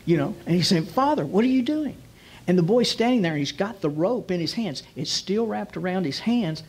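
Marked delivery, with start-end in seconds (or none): 0.9-2.0 put-on higher-pitched boy questioning his father; acted alarmed question. Boy to his father tying his hands, alarmed question. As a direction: play a mildly startled son.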